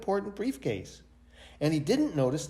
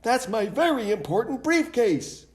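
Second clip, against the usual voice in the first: high, louder desperate shout; 9 semitones higher, 4 dB louder than usual. A narrator's reading. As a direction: shout to stop the ride. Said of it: "shouted Mister Brown".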